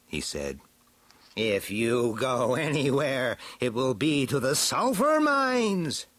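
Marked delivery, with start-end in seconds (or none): 1.3-6.1 villain's threat climbing to high shout. A narrator's reading. Skeletor threatening Beastman in the hover car, shouting. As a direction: start measured, build to a high drawn-out cry. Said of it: "shouted Skeletor".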